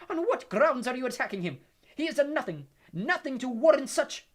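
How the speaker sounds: indignant, high, loud shout; Professor McGonagall. A narrator's reading. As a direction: demand answers at full volume. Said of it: "said Professor McGonagall's voice".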